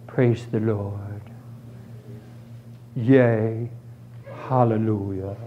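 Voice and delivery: low, slow, hollow churchgoer praise; mock churchy praise. Preacher mocking people who just make church noises, hollow praise. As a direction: parody empty church noises.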